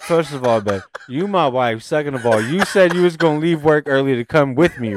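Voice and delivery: retold protest, building in volume; indignant comeback. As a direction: lay out your case, exasperated. Retold indignant argument with wife.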